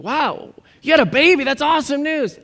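high, bursting delight; excited congratulations. Acted. Friend hears of a new baby, excited congratulations. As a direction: react as if thrilled for them.

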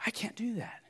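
soft whispered grumbling bondservant; hushed resentful slave protesting; whispered complaint. Acted. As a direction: whisper your objection under your breath. Bondservant refusing.

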